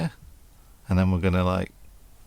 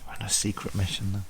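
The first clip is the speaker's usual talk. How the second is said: whisper; 6 dB quieter than usual. Pretend. Joking secret mission whisper.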